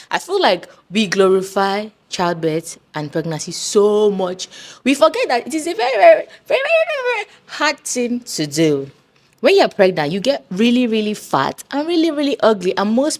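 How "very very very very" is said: each very climbing higher and louder; rising emphasis. Acted. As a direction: pile on the verys, going up. Stressing how hard childbirth is.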